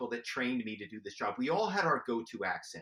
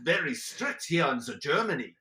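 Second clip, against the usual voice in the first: a lightly put-on German accent, louder; 3 semitones higher, 5 dB louder than usual. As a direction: brush off the question in a mock German accent. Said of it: "My go-to was German".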